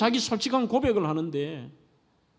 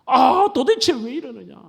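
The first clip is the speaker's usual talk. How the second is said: anguished cry; 5 semitones higher, 4 dB louder than usual. Retold or imitated. Voicing despair, why me.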